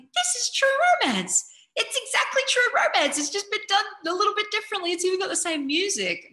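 high, loud mock outburst; excited realization. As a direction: re-enact an astonished discovery, voice shooting way up. Acts out recognizing a copied film.

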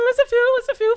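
high-pitched, teasing singsong; flirty high-pitched musing; playful cooing. Acted. Playfully acting out ogling the comedians.

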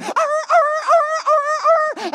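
very high, loud whimpering yelps; dog whimpering. Retold or imitated. Scolded dog running home, high whimpers. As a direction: whine like a scolded puppy. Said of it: "that little old dog ... went".

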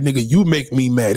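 usual speaking voice

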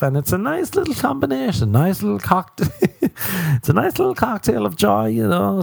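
high, singsong mock delight; sarcastic cheeriness. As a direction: chirp it as if misery were a treat. Sarcastic about a miserable combination.

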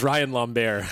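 "Lambert" said French-style, "lom-BAIR"; French pronunciation. Pretend. Co-host jokingly correcting "Lambert", French pronunciation. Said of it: "No, it's actually".

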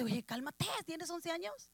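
father quietly calming his frantic daughter. Acted. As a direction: settle the panicking girl gently. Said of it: "el papá le dice".

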